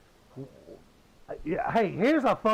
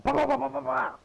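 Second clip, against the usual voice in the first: rapid mock gunshots, the last ones higher; gunfire noises; 5 semitones higher than usual. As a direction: spit out quick pretend shots. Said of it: "It's like".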